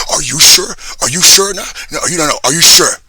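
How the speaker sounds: full-volume enraged rant; furious shout. Real, not acted. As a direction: scream the accusation at them. Ranting at an imagined accuser.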